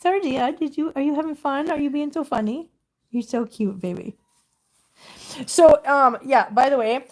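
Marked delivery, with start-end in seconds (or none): 0.0-4.2 raised pitch, teasing a kitten; playful pet talk. Real, not acted. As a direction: coo questions at a little pet.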